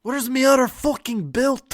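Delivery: loud, high mock Irish drifting into Scottish; hammed-up Celtic accent, loud and high; Conor McGregor. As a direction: yell like an Irish prizefighter. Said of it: "we gotta do Conor McGregor".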